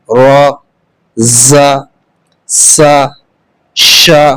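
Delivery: slow letter-by-letter drill with pauses between; alphabet drill. Shown as a demonstration. Teacher drills the students through each letter with zabar, slowly and clearly. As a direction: call out each letter clearly, one at a time, for children to repeat.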